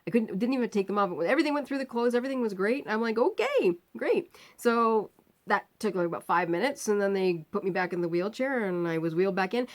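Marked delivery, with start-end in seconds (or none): 3.2-4.4 high-pitched, relieved okay; pleasant surprise. Retold or imitated. Patient finds the scan is simple, pleasant surprise.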